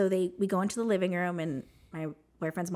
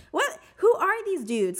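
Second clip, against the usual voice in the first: indignant disbelief; 13 semitones higher, 6 dB louder, slower than usual. Retold or imitated. Indignant at elders' intrusion.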